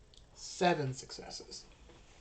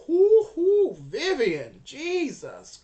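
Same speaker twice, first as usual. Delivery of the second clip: high, loud whooping; whoop, then exclaim in amazement; real excitement; 10 semitones higher, 13 dB louder, slower than usual. Real, not acted. Game master reacts to Vivian's critical success, excited whooping.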